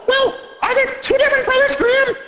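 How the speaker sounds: put-on high kid voice picking at details; a sharp little kid arguing; quibbling child. Child arguing with the reader about the quotes, high kid voice.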